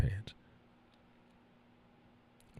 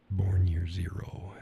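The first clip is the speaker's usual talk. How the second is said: slow whispered aside, under the breath; a slow little whispered punchline; 3 semitones lower, 7 dB quieter than usual. Real, not acted. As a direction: whisper it slowly like a secret joke. Joking aside to herself.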